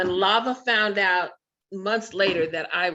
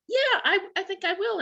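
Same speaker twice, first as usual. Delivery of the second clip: much higher, playfully eager yes; eager mock reply; 14 semitones higher than usual. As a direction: play along like you're delighted to be tempted. Her own eager reply, retold.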